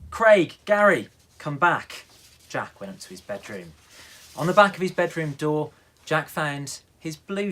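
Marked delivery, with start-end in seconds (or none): 0.1-2.0 loud, high call after the kittens; calling out angrily. Acted. Mum shouts after the dripping kittens, loud and high. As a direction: shout the cats back in. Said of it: "Mum went mad".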